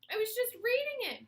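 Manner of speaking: high, loud flustered exclaim. A narrator's reading. BB exclaims to her teacher she wasn't listening. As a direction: protest in a flustered rush. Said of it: "B B exclaimed".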